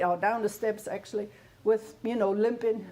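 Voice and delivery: ordinary delivery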